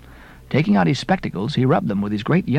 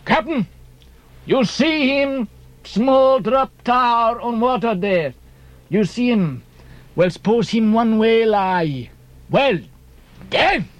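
put-on high, slow foreign-accented harpooneer's call; pitched-up, drawn-out broken-English accent; Queequeg; 6 semitones higher, slower than usual. A narrator's reading. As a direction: cry it out in halting accented English. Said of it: "cried out in some such way".

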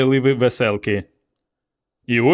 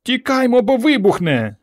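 high panicked cry to run; a girl shrieking that it will explode; alarmed high girl's cry; frightened cry; 12 semitones higher than usual. A narrator's reading. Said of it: "скрикнула Варка".

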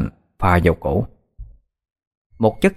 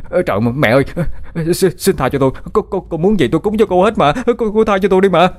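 much higher, stammering panicked begging; terrified pleading; 9 semitones higher than usual. A narrator's reading. Bích begs the grabbing hand for mercy, terrified stammer. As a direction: beg for your life, voice climbing in terror. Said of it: "Nó sợ hãi la lên".

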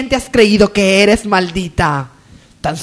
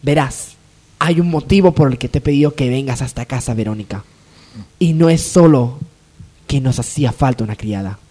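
sinister low voice; 10 semitones lower than usual. Acted. Adriana tells the maid she owes her a favour, low and sinister. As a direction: sink the voice like a villain plotting.

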